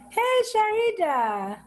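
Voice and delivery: high, drawn-out happy hello with laughter; delighted greeting. Real, not acted. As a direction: greet a friend you're thrilled to see. Delighted greeting to someone joining.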